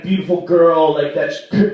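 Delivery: ordinary delivery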